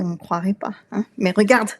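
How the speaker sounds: ordinary delivery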